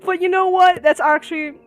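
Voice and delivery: very high loud screams and laughing disbelief; real stunned outburst; shocked screaming. Real, not acted. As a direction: yell at the screen after the sudden death. Player character is shot dead.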